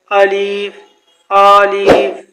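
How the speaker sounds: الف with the first vowel dragged out long, "aa-leef"; wrongly lengthened. Shown as a demonstration. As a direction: stretch the opening A of الف. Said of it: "یہ غلط ہے، لمبا کر دیتے ہیں".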